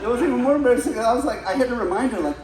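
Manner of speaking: normal talking voice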